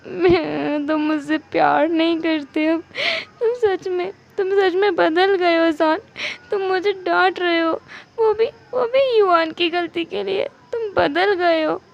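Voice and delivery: high, whiny sobbing complaint; tearful reproach. Acted. Spouse telling husband he has changed, acted weepy. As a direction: wail it like a wounded, dramatic spouse.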